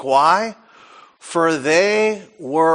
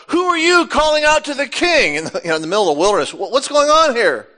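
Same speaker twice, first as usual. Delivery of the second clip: higher, confused roused guard; startled Abner; 7 semitones higher, faster than usual. Acted. Abner shouted at by David across the hill, baffled. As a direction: play Abner jolted from sleep, baffled. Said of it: "And Abner answered and said".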